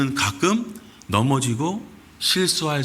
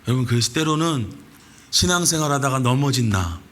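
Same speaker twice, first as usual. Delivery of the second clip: ordinary talk, nothing marked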